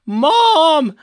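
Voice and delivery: loud, high mock yell; yelling Mom. Victor crying out to his mother, mock yell. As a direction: call out Mom at full pitch. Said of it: "he's yelling out".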